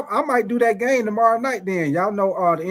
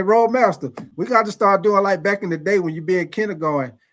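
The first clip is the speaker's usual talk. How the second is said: ordinary talk, nothing marked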